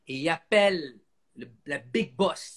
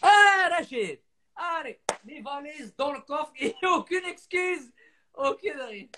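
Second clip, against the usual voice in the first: cheeky driver; 10 semitones higher, slower than usual. Retold or imitated. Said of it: "il a fait".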